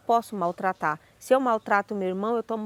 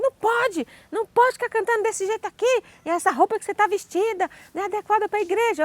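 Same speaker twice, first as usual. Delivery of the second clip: high, scolding church lady voice; mock scolding, high and fast at the end; stern church secretary; 11 semitones higher than usual. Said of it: "Ela falou assim".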